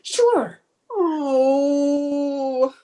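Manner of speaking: high, loud wail of despair; hammed-up high bawling; comic wail. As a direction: burst out and let it stretch into a long moan. Cook breaks down after dinner is ruined.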